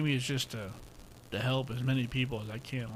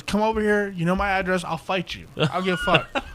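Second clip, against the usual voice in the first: loud, higher, a play-tough buddy daring him; mock fight challenge; 6 semitones higher, 8 dB louder than usual. Buddy dares him to come fight over money, loud mock challenge. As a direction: shout the dare like a friend who won't take no. Buddy's joking threat, retold.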